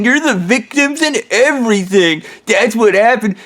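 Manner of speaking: high, slow, sneering mimicry; mock their sob stories with contempt; mocking whiny victims. Ham Man mocking the people he beat up.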